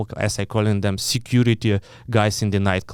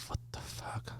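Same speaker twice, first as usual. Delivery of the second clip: breathy whisper of disbelief; 22 dB quieter than usual. Retold or imitated. Whispering to the listener in stunned disbelief.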